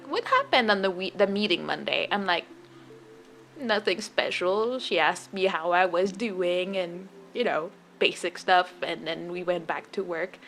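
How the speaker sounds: hushed retelling. Retold or imitated. Talking quietly with the accounting lady behind a closed door. Secret talk in closed office.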